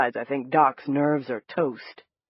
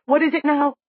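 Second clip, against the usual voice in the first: panicked; 9 semitones higher, 5 dB louder than usual. A narrator's reading. Panicked follow-up question.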